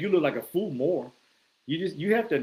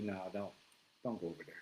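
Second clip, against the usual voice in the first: much lower and softer warning; a low murmured caution from within; hushed gut feeling; inner voice; 7 semitones lower, 15 dB quieter, faster than usual. Retold or imitated.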